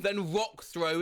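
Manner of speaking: normal talking voice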